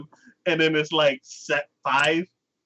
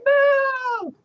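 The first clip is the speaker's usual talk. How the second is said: very high, squeaky little no; high-pitched mock no; squeaky mock refusal; 4 dB louder than usual. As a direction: pipe it up like a cartoon girl. Friend retelling a scene.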